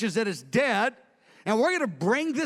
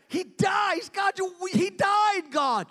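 a stunned leader crying out to God after a death; anguished outcry; 9 semitones higher, slower than usual. Acted. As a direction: cry it out in shock and grief. David's anguish over a man's death.

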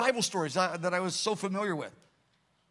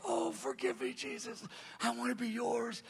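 much higher, soft, tearful plea; pleading woman; 7 semitones higher, 10 dB quieter than usual. Retold or imitated. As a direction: voice a woman begging forgiveness, high and hushed. Woman crying out for forgiveness.

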